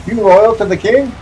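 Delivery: playful imitation of a soldier; mock redcoat interrogation. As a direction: grill him like a suspicious soldier. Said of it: "the British soldiers would be like".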